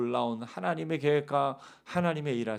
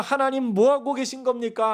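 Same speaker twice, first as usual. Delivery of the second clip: complaining to God; 8 semitones higher, 4 dB louder than usual. Retold or imitated. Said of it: "하면서 하나님을 원망할 때가".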